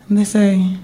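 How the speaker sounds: normal talking voice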